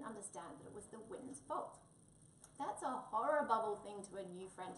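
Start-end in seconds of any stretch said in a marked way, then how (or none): 2.6-4.8 a louder, cross little growl. Acted. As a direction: growl it out at the scarecrow, offended. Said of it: "he growled".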